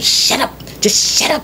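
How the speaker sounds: hissed, unvoiced shut up; mock a frustrated parent hissing; imitating frustrated parent. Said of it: "frustrated and just go".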